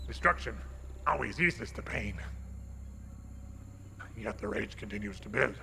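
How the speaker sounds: low, gravelly brooding dragon. Acted. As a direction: rumble out a dragon's rage. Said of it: "kind of gravelly dragon voice".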